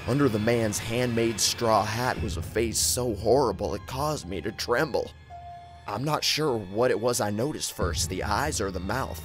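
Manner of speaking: ordinary delivery, nothing marked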